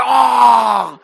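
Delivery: loud, startled "Oh" of an embarrassed crowd; shocked gasp. Retold or imitated. Preacher mimicking the congregation's shocked gasp, loud. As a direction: blurt it out like the whole room flinched. Church crowd awkward clench reaction.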